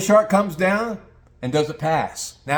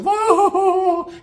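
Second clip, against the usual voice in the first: high, sustained crowd whoop; long high "whoooa"; crowd "whoa"; 4 dB louder than usual. Retold or imitated. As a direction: imitate fans letting out a long whoa. Imitating the fans' reaction.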